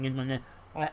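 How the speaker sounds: low mock munching noises; imitating munching. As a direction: mime someone stuffing food in. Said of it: "He's probably like this".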